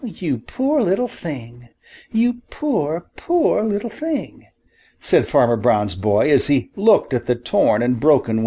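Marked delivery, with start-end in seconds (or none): 0.0-4.5 high, soft and tender; tender pity. A narrator's reading. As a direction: coo over a hurt bird. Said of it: "very tenderly lifted".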